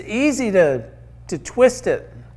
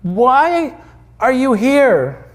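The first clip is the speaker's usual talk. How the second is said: slow, higher, spaced-out question; frustrated; 5 semitones higher, much slower than usual. Acted. Angel supervisor out of frustration with the thief, exasperated. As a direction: press each word in exasperation. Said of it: "Out of frustration".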